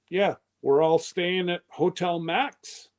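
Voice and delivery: ordinary talk, nothing marked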